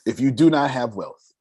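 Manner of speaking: ordinary talk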